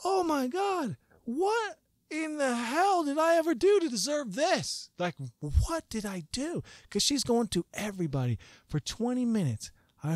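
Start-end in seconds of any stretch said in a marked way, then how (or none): 0.0-6.6 high, hammed-up lament; despairing wail.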